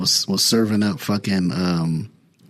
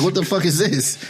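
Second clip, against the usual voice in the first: higher, outraged retold quote; mock outrage; 9 semitones higher than usual. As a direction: replay the group's disgust, pitched up. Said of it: "we was all mad".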